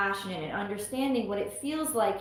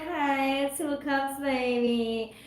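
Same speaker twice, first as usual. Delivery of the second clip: ordinary talk, nothing marked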